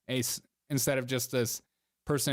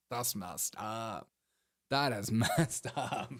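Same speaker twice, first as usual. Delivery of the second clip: slow mock scold; 4 semitones lower, 5 dB quieter, much slower than usual.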